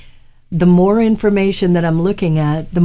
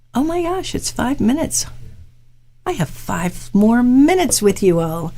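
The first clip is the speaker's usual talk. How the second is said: surprised; 5 semitones higher than usual. Real, not acted. Therapist realising the session is nearly over, surprised. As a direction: gasp at the clock, voice jumping up. Notices time almost up.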